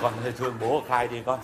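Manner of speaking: tearful plea. Acted. Father begging daughter to confess, low tearful plea. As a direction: beg her in a broken low voice.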